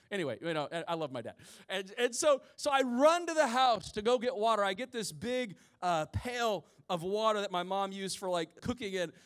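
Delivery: ordinary talk, nothing marked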